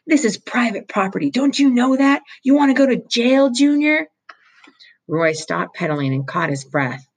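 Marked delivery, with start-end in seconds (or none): 0.1-4.1 much higher and louder, an angry foreman's shout; furious holler. A narrator's reading. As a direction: chew the trespassing kid out at full volume. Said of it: "the foreman hollered".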